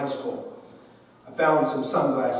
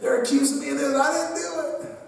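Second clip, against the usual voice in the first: put-on high, pleading teen voice; mock whimpering complaint; whiny kid; 9 semitones higher than usual. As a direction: whine to dad that they're picking on you. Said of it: "I'm just telling Dad".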